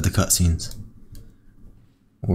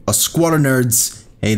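normal talking voice

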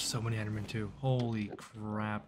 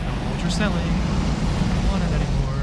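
mock yell at dragon; 4 semitones higher, 11 dB louder than usual. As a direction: shout it at the beast like a pushy salesman. Yelling at the dragon.

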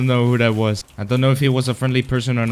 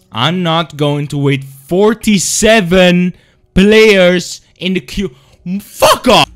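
angry rant; 7 semitones higher, 7 dB louder than usual. Real, not acted. Long server queue rant.

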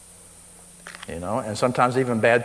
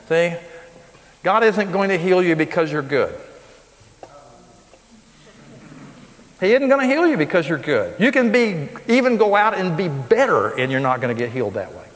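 preaching at full force; 5 semitones higher, 3 dB louder than usual. Acted. Preaches emphatically to congregation.

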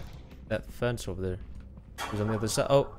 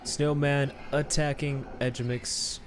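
ordinary talk

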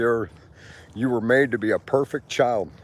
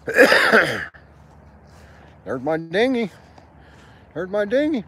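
excited; 8 semitones higher, 4 dB louder than usual. Real, not acted. Excited at seeing boat.

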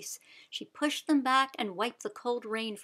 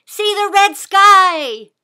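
slow, much louder and higher; urgent shout; 9 semitones higher, 17 dB louder, slower than usual. A narrator's reading. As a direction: point and shout the warning. Pointing east, calling.

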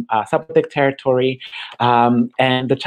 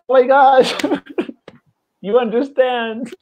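much higher, delighted, breaking into a laugh; excited gasp; 11 semitones higher, 4 dB louder than usual. Retold or imitated. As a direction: squeal it with joyful relief. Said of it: "and I was like".

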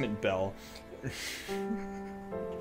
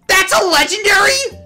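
high-pitched loud yell; shocked disbelief; 10 dB louder than usual. Real, not acted. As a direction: screech it in astonishment. Shock at a legendary drop.

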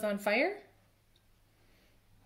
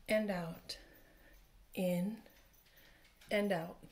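guided breathing; 5 dB quieter than usual. Acted. Teacher guiding children to breathe, soft and calm.

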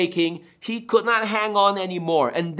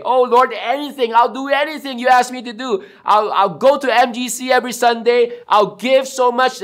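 higher, breathless-to-please bargaining, acted; eager desperate promising; 4 semitones higher, 3 dB louder than usual. Man on branch bargains with God, acted desperate eagerness. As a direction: promise anything to be rescued.

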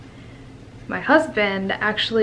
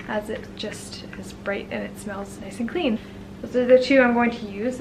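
normal talking voice

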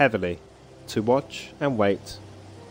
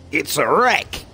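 put-on raised, louder Dead Island accent; put-on Dead Island game accent; 5 semitones higher, 5 dB louder, slower than usual. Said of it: "Kind of the Dead Island, um, accent".

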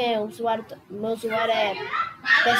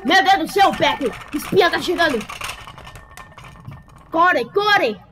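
being chased; 4 semitones higher than usual. Real, not acted. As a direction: run for your life, shouting. Fleeing the spy in game.

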